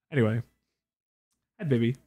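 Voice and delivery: usual speaking voice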